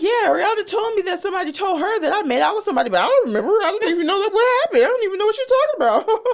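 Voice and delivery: high, rushed mock rambling; flustered denial. Husband explaining rumor to wife, mock flustered. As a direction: babble excuses in one fast run. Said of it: "He was like".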